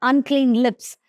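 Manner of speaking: ordinary talk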